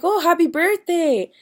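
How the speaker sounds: high, loud, drawn-out birthday wish; imitated delighted congratulations. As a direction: imitate someone gushing at a birthday fan. Star congratulates fan, retold.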